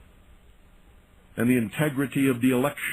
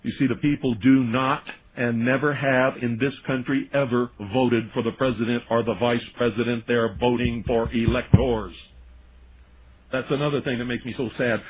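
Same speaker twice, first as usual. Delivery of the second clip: ordinary delivery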